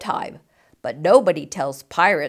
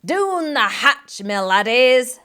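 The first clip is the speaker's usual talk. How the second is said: slow, rolling pirate toast; hearty pirate captain drawing out doon; pirate yell; 4 semitones higher, slower than usual. Acted. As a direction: bellow the toast at the table.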